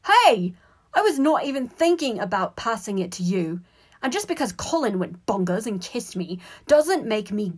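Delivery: loud, high, heated defence; heated, defensive outburst; indignant protest. A narrator's reading. Ron and Harry quarrel.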